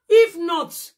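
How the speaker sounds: real fury, full voice; angry shout. Real, not acted. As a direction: bark it loud and high. Furious rant at rival.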